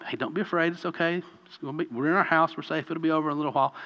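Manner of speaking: put-on soft, hushed comfort to a frightened kid; soothing a scared child. Parent hushing a child during a storm, soft and soothing. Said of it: "you may go into your kid".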